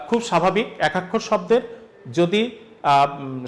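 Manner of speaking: ordinary talk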